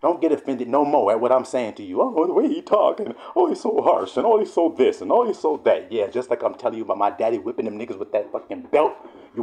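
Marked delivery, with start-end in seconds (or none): 2.0-5.9 high-pitched mock whining of critics; singsong mock complaint, easing down at the end; mocking complainers. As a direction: imitate fussy critics in a raised voice. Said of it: "y'all should be happy I'm speaking like this".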